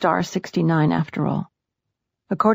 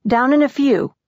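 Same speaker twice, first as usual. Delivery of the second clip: louder, higher called reply; raised voice meant to carry; calling out; 7 semitones higher, 5 dB louder than usual. A narrator's reading. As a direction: call it back through a closed door. Calling back through a closed door.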